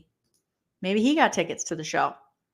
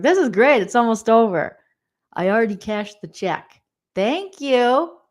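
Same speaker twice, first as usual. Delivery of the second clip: mock host; 3 semitones higher, 4 dB louder than usual. Vlogger mocks Jerry rushing the interview, louder fake-cheery host. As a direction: sell it like a host counting the minutes. Imitating Jerry eager to finish.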